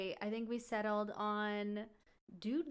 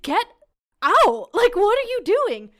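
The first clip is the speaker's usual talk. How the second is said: high, full-force acted shout; outraged yell; 12 semitones higher, 18 dB louder than usual. Woman ordering man out of her bed, outraged shout. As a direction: explode at him in disbelief.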